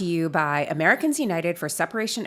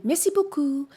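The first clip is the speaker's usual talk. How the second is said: high-pitched French thank-you; French accent; 9 semitones higher, 3 dB louder than usual. Retold or imitated. Traveler thanking a Paris shop, French accent. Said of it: "in a perfect French accent".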